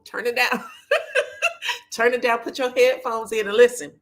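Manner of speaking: laughing. Real, not acted. Warning viewers watching at work.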